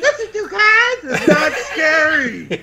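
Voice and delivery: high, slow mocking chipmunk squeak. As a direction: imitate him in a squeaky chipmunk voice. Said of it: "I keep telling you".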